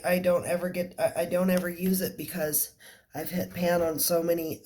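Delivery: ordinary talk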